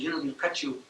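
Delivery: quietly retold threat; elders' threat. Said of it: "our elders talking, telling them".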